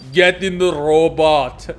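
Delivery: loud, slow command. Retold or imitated. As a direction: order it loudly. Quoting the Eva line.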